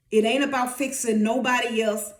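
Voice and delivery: ordinary talk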